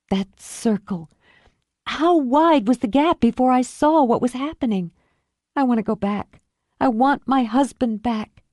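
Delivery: high, aching longing; pining for the happy past; wistful heartbreak; Julie, grieving. Acted. Julie mourns her marriage.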